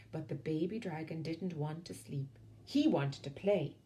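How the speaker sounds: ordinary delivery, nothing marked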